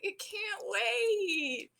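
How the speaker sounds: put-on high, slow, drawn-out child whine; whiny little girl. Little girl begs her father, high slow childish whine. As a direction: stretch it out like a desperate kid.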